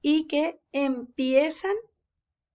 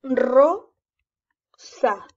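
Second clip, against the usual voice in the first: "rosa" broken up, a loud rolled ro... then sa; syllable by syllable; 6 dB louder than usual. Shown as a demonstration. Teacher modelling reading for a pupil, syllable by syllable. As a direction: sound out "rosa" with a long gap between syllables. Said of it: "puedes leer en sílaba".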